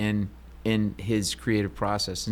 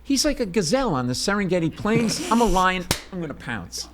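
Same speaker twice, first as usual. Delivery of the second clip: hyped inner voice; 8 semitones higher than usual. Retold or imitated. Inner pep talk before approaching.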